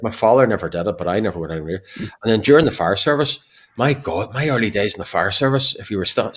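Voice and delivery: ordinary delivery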